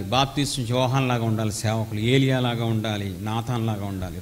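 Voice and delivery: ordinary talk, nothing marked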